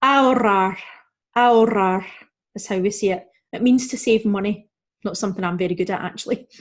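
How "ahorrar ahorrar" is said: ahorrar as "a-orrar" with trilled RR; Spanish ahorrar, no H, trilled double R; silent H, rolled R. Shown as a demonstration. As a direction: drop the H and roll the RR in ahorrar. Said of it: "our H is silent and we're gonna roll".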